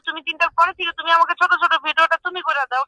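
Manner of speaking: normal talking voice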